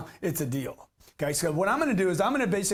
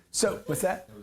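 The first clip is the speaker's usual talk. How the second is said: ordinary talk, nothing marked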